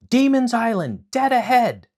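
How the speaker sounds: high, loud excited shout. Acted. Momotaro shouts to his crew as the island appears, loud. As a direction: yell it across the deck. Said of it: "he shouted".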